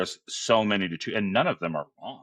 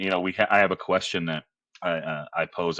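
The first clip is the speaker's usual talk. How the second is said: normal talking voice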